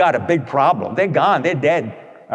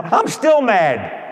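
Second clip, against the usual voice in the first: stubborn reply; 5 semitones higher, 4 dB louder, much slower than usual. Retold or imitated. Quotes the patient's stubborn reply.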